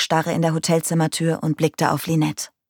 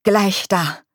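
slightly strained, tense reply; forced out; 3 semitones higher than usual. A narrator's reading. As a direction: press the words out under mild strain. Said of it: "presste dieser hervor". (gepresst hervorgebracht)

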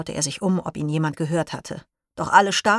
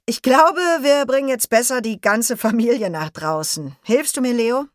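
higher, louder, jovial laughing-through dad; cheerful father; 6 semitones higher, 4 dB louder than usual. Acted. Leo's father calms the excited class, higher and jovial. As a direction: wave the noisy class down with a happy laugh. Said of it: "Leos Vater hob lachend die Hände".

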